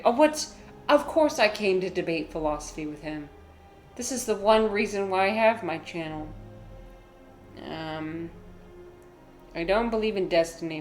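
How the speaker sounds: usual speaking voice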